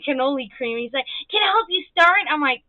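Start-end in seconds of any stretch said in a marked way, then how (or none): 1.3-2.3 high, loud child impression; keen kid voice, lifting at the end; eager little kid. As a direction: imitate a small boy asking to help. Voicing eager small boy offering help.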